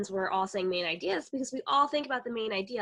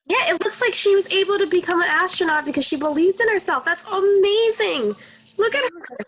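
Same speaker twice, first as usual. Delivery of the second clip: louder, higher, thrilled reaction to the ending; excited praise; 7 semitones higher, 10 dB louder than usual. Real, not acted. Co-teacher reacts to story ending.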